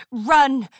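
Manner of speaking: loud urgent yell. A narrator's reading. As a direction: shout it to get them moving. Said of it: "Apple Bloom yelled".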